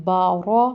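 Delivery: با را with a light "ba" and a heavy rounded "ro"; thick ra, light ba. Shown as a demonstration. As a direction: thicken only the را in با را. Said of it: "را موٹا کرنا ہے، با موٹا نہیں".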